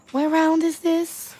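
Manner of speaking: high, loud, slow teasing call; playful shout. Acted. Teasing the lovers about their stamina: loud, slow playful call.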